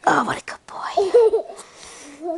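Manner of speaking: high, slow breathy coo of praise. Real, not acted. Praising baby.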